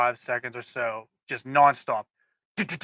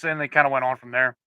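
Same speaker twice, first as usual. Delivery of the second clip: usual speaking voice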